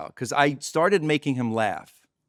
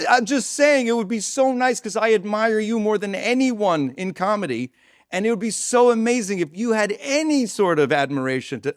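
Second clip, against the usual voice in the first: higher, gushing insistent plea; acted pleading; 7 semitones higher, 4 dB louder than usual. Comedian begs his idol for some admiration, pleading. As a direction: press your case as an overeager fan.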